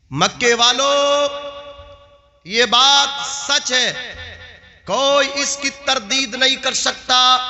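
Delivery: high, sustained oratorical chant; slow, ringing proclamation that builds into forceful preaching; declamatory preaching. Acted. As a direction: proclaim it to a crowd, stretching the phrase endings. Preaches Abu Talib's sermon speech.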